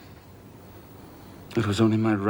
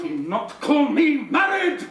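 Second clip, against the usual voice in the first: much higher, louder, slow half-laughed cry; anguished laughing shout; 4 dB louder, slower than usual. Acted.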